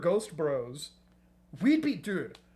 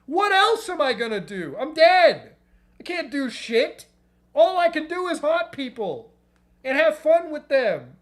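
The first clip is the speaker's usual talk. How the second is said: worked-up rant; 6 semitones higher, 6 dB louder than usual. Real, not acted. Ranting about ghost life.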